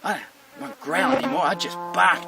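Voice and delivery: lightly acted gloating; a hint of a cocky dog; self-satisfied dog. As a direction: marvel as a dog pleased with itself. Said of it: "the dog again goes".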